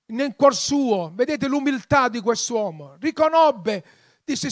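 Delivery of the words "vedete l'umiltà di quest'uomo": ordinary talk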